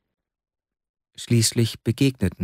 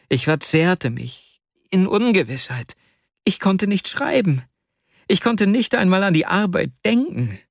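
higher, distraught pleading voice; anguished young clerk; 6 semitones higher than usual. Acted. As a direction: pour out a guilty heart. Vasja's anguished confession voiced. (verzweifelter Vasja, höher und flehend)

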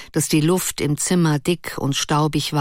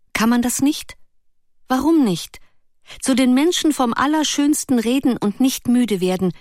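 put-on higher, eager young girl's voice; girlish Ruth; 7 semitones higher than usual. Ruth eagerly explains her idea to Erik, higher girlish voice. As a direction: lift into the lively girl's voice.

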